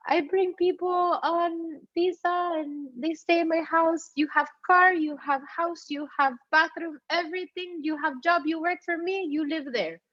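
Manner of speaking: high, broken-English Israeli accent impression. Israeli lady offering car, house and job, Israeli accent. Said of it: "She's, like, this Israel lady".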